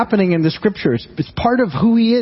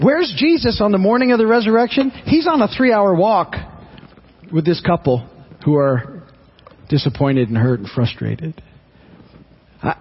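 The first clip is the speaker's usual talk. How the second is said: normal talking voice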